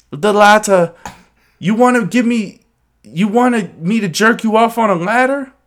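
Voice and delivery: high, loud mock-British voice; put-on British accent. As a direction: answer in a hammed-up British voice. Continuing the accented dialogue bit.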